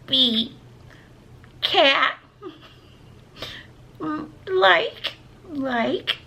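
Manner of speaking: put-on high, halting little-kid reader; baby voice. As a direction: pretend to be a small child reading word by word. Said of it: "my baby voice".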